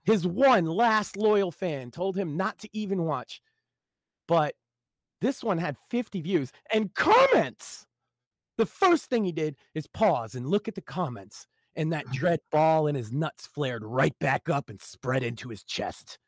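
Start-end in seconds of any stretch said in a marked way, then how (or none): none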